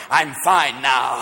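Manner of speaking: low, slow, stretched-out assurance; feigned serenity. Acted. Patient at Betty Ford, slow low mock reassurance. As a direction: insist slowly that all is well.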